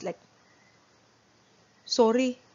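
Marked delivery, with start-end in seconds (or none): 1.8-2.4 careless sorry. Shown as a demonstration. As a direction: toss off "Sorry." without meaning it. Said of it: "you say it like this".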